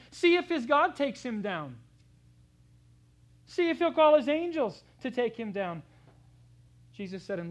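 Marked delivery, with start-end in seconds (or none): 0.1-5.8 loud jeering crowd; mocking taunt. Preacher voicing mockers taunting Jesus on the cross, loud jeer. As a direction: sneer it up at the man on the cross.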